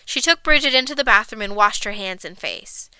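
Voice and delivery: usual speaking voice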